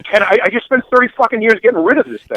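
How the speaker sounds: indignant retort, retold; retold exasperation. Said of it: "I'm like".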